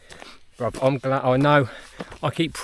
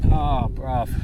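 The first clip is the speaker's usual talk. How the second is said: ordinary delivery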